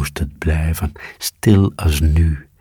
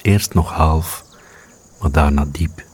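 ordinary delivery, nothing marked

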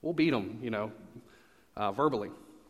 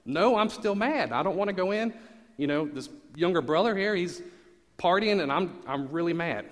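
mock angry brother; 6 semitones higher, 5 dB louder than usual. Acting the angry older brother.

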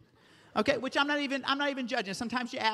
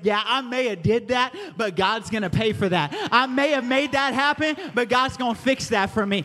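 louder, preaching with swagger; confident declaration; 2 semitones higher, 4 dB louder than usual. Retold or imitated. Preacher voicing the carefree believer, loud and sure. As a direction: declare it boldly, unbothered. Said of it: "walk around like Andy saying".

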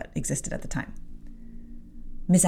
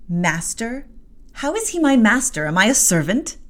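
defiant retort; 6 semitones higher, 5 dB louder than usual. Acted. Jane defies the maid.

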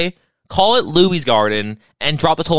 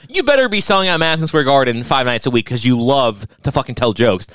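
ordinary delivery

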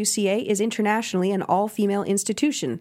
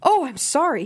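higher, startled "Oh, I'm sorry"; gasped apology; 6 semitones higher than usual. A narrator's reading. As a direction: caught off guard, blurt it out. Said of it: "he gasped".

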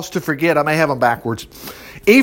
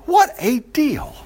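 higher, slow, savoured; delighted repeat; 9 semitones higher, slower than usual. Real, not acted. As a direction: stretch it out in amused wonder. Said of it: "Man, what a deal".

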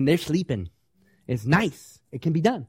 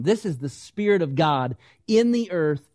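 ordinary talk

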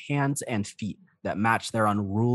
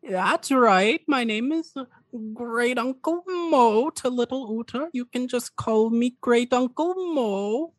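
put-on slow, high-pitched old man with a European accent; old dragonborn uncle; 13 semitones higher, slower than usual. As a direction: introduce yourself slowly as a high-voiced elderly uncle. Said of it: "and the old man just goes".

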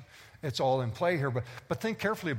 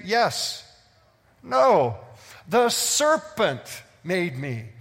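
loud and much higher, pounding the point home; fervent pulpit preaching, pushing hard; preaching at full force; 5 semitones higher, 6 dB louder, slower than usual. Acted. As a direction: fire it at the congregation.

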